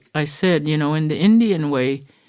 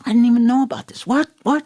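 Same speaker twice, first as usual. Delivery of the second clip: higher, fast startled blurt; mock surprise; 7 semitones higher, much faster than usual. Therapist blurting out, caught off guard, fast and high. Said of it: "He goes".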